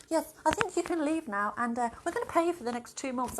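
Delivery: hammed-up boss letting her go politely; imitating the boss. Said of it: "And he was like".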